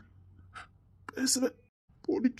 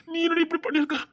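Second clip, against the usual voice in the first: distressed crying shout; 7 semitones higher, 5 dB louder than usual. Acted. Learns she stopped eating.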